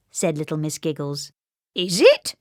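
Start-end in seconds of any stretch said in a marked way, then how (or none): 1.7-2.3 louder teasing question; chuckling. A narrator's reading. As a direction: tease her with a chuckle. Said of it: "chuckled Mister Happy".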